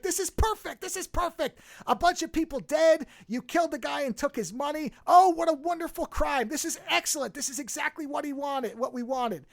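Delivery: gleeful cops. Retold or imitated. Cops gloat over the crime.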